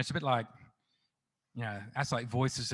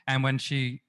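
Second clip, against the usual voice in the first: normal talking voice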